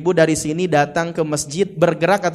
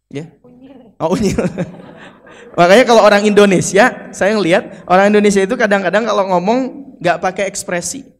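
ordinary delivery

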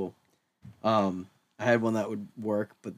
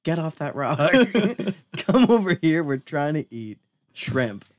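Australian surfer; 4 semitones higher, 6 dB louder than usual. Pretend. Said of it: "I'd be like".

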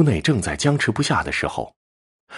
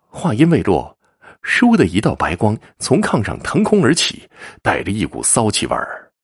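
plain reading voice

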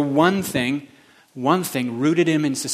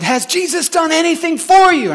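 much higher, louder voice of the woman preacher; preacher lady's challenge; 14 semitones higher, 7 dB louder than usual. Retold or imitated. Preacher lady challenging the congregation, high and loud. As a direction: call out to the crowd like she did.

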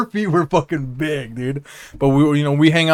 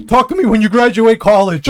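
higher and louder, cocky line; retold comeback; 6 semitones higher, 5 dB louder than usual. Telling friends his line to the girls, louder and cocky. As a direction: toss it off and drive away.

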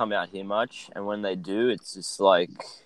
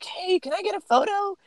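much higher, squeaky fan voice pleading for a photo; mock eager fan. Airport worker mocking fat wrestling fans asking for photos in a high squeaky voice. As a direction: ham up a starstruck fan begging.

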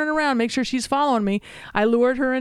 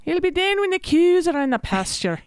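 high-pitched mock Scottish accent; imitated Scottish grandfather; 9 semitones higher than usual. Daughter redoing mother's grandfather imitation, high Scottish accent. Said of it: "try to imitate her grandfather".

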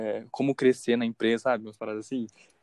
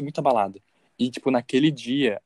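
normal talking voice

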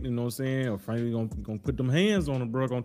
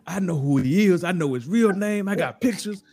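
higher, slowing on the name like a reveal; mock threatening caller; 5 semitones higher, slower than usual. Quoting the caller on the radio, slow mock reveal. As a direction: lay out the name slow and heavy.

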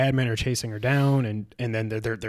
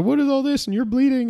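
startled question; 13 semitones higher than usual. Retold or imitated. Retelling a startled character.

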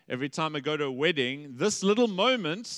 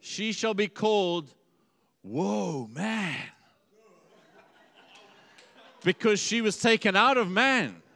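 higher, laughing mock-solemn naming; hammed-up proclaiming; 5 semitones higher, 5 dB louder than usual. Adam naming Eve, a laughing grand announcement. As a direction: announce her name grandly, breaking into a laugh. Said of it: "He shouts out".

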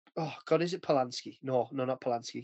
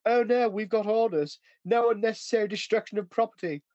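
higher, slightly slowed mock officer voice; British war-film officer; 6 semitones higher than usual. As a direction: imitate a by-the-book British officer refusing. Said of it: "all the British always in those films".

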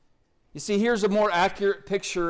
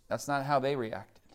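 usual speaking voice

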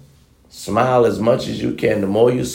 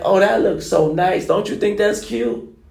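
put-on higher, sweetly hinting girlfriend voice; 8 semitones higher than usual. Man imitating his girlfriend hinting at a store window, sweet and higher. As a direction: coo it like she wants you to buy it. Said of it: "be like".

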